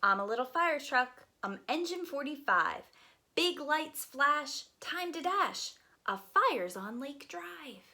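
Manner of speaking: lively, sing-song picture book reading; reading to children. Acted. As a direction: read it aloud to a group of little kids.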